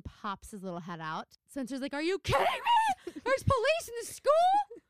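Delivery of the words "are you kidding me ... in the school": high, loud squeal of disbelief; exaggerated incredulous shriek; mock outrage. Quoting Spencer on finding police at school, mock-outraged squeal.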